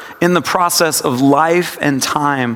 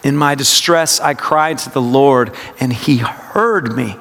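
meditative reading, weight on HEARD; stress on HEARD; 3 semitones lower than usual. Shown as a demonstration. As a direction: draw out "heard" toward the end. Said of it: "it's a practice of word emphasis".

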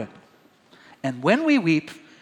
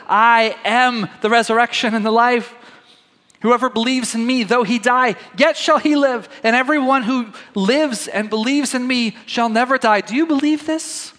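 higher and louder, solemn proclamation; proclaiming Jesus; 7 semitones higher, 4 dB louder than usual. Acted. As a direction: ring it out like a promise. Jesus's words, read with emphasis.

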